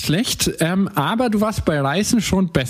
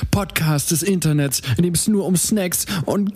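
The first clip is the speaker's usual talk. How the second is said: dramatic narration; 3 semitones lower than usual. Acted. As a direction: set the scene like a movie trailer. Podcast intro scene setting.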